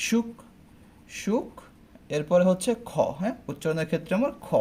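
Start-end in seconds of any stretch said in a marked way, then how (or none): 0.1-1.5 সূক্ষ্ম said as সুখ, the m silent; careful pronunciation. Shown as a demonstration. Teacher to class, showing সূক্ষ্ম sounds like সুখ. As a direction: say সুখ slowly, shuk with a breathy kh. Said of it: "উচ্চারণটা কেমন হবে".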